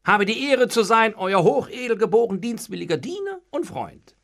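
elaborate greeting, high and loud; ceremonious. A narrator's reading. Servant greeting the gentlemen, ceremonious. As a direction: lay on the flowery formality. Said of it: "begrüßte sie umständlich".

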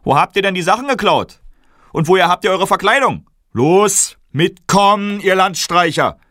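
high, loud accusing bark; guard shouting at the kings he takes for thieves; angry guard shout. Acted. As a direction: bark at the tramps.

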